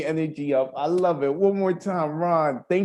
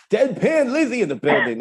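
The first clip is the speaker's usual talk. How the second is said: louder, higher hype call for the next poet; announcer hype; 5 semitones higher, 5 dB louder than usual. Real, not acted. Host calling the next poet to the stage, hyped. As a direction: bring her on like a ringside announcer.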